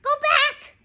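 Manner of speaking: very high, loud parrot voice; parrot squawk. A narrator's reading. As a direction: screech it like a warning bird. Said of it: "squawked Polly".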